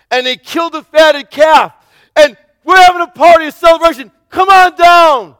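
high, loud excited shouting; elated servant. Acted. Servant calling the older son to the party, shouting with joy. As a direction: shout the good news at the brother. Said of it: "the father's servant is elated".

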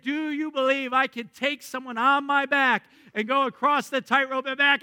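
boastful circus pitch; showman hyping crowd. Retold or imitated. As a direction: rev up the crowd. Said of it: "He said".

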